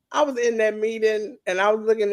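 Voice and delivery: ordinary talk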